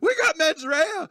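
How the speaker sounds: very high, drawn-out mock wail; hammed-up panicked squeal; flipping out. Wilder's character flips out over what he lip-read, mock hysterical. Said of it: "He starts flipping out".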